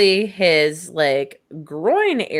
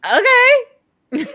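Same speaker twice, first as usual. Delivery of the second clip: very high, loud, stretched-out word; exaggerated high-pitched uncertainty; mock baffled okay; 7 dB louder than usual. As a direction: squeak out a dubious agreement. Acts out doctors agreeing in bafflement.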